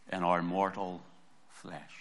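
ordinary talk

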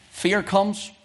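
ordinary delivery

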